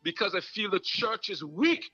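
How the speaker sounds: rising, fervent sermon cadence; preaching. Acted. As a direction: preach it to the congregation with fire. Preaching at full force.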